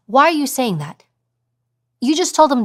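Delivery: normal talking voice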